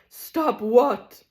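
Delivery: defiant question; tragedian. Acted. Tragedian retort.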